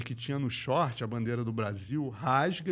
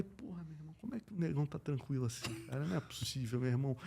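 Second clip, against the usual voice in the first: nervous aside; 2 semitones higher, 9 dB quieter, faster than usual. Retold or imitated. Retold nervous aside to himself.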